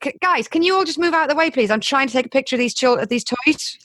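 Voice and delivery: high, fast, bossy-polite; polite request. Retold or imitated. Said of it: "I just turned around and went".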